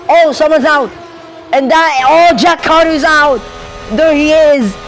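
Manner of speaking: high-pitched, startled race call; excited commentary. Real, not acted. Commentator calling Jack Carter out of the race, excited. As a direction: react live as a driver crashes out, pitch jumping up.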